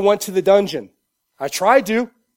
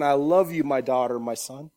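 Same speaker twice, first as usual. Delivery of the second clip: tender God; 4 semitones lower, 4 dB quieter than usual. Retold or imitated. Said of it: "got you by the face going".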